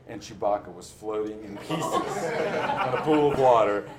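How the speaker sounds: breaking into laughter mid-sentence; laughing. Real, not acted. Telling of the flooded basement, laughing. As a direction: laugh at the absurd memory.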